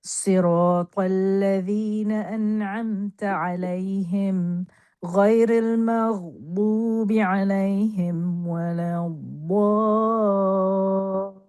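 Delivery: ordinary delivery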